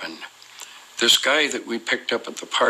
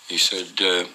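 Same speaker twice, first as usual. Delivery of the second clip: ordinary delivery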